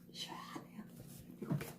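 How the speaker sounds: breathy whispered aside. Real, not acted. Teacher describes summer colors to viewers, whispering. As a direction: let it out in a cool hushed whisper.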